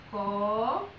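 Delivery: CORAÇÃO broken up with long pauses, CO... RA... ÇÃO; each syllable of CORAÇÃO held and set apart. Shown as a demonstration. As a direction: count out CORAÇÃO one syllable at a time. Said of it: "Perceba que nós abrimos a boca".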